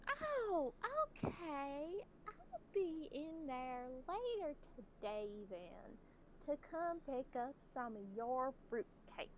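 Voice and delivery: put-on high, slow old lady voice; old lady caller. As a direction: float it up like a sweet old caller.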